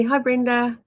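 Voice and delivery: usual speaking voice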